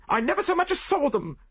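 high, loud indignant protest; heated. Acted. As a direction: insist hotly in a high voice. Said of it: "she declared heatedly".

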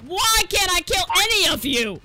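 loud, exasperated complaint; frustrated yell. Real, not acted. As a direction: yell it at the other players. Frustrated at failing to kill.

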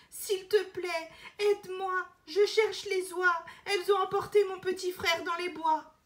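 put-on urgent little-girl plea; pleading girl. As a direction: beg like a frightened girl. Elena begs each helper.

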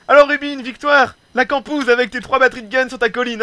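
loud, high-pitched teasing jab; playful loud ribbing; mock taunt.

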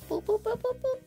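high, even little boops; alien beeping. Pretend. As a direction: beep like a tiny alien.

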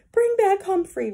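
put-on loud, high child's shout; loud demand. A narrator's reading. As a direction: demand it at the top of your voice. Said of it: "said in a voice as loud as A J's".

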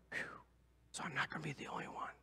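quick whisper of relief; relieved whisper. Retold or imitated. Whispering to himself in relief in the prayer line. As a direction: breathe it out fast, relieved.

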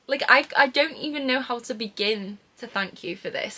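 ordinary talk

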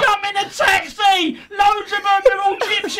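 hammed-up urgent warning; mock panic shout. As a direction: shout a fake alarm, half laughing. Acting his prank warning, shouted.

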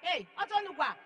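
retold shout. Woman calling her son to fetch the oil, mild shout. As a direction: call out for the dew from heaven. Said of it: "I started shouting again".

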